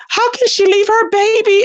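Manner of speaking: put-on high, wailing plea over the baby; a touch of hammed-up tears; mock tearful outrage. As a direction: imitate a crying panel member. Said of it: "This woman was literally crying".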